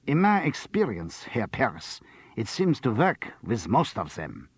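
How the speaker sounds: mock Viennese doctor, slow and pompous; cod Viennese accent. John B joking to Charles in a cod Viennese accent. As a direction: put on a hammy Viennese accent. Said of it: "dropped instantly into a cod Viennese accent".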